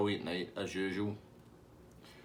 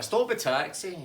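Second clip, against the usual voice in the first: high, loud urgent call; Tasty Dave; 9 semitones higher, 5 dB louder, slower than usual. Retold or imitated. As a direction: call out to halt the driver. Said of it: "Tasty Dave's like".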